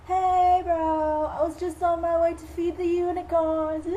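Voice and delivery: put-on high, loud, singsong dreamy girl; dotty little sister. Sister off to feed the unicorns, dotty and cheery. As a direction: pipe up in a loud put-on girly voice. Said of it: "My sister, who's a little bit".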